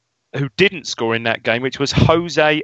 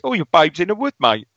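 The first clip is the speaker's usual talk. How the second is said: higher-pitched put-on Cockney; 5 semitones higher than usual. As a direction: do a chirpy Cockney line. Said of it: "He's a Cockney".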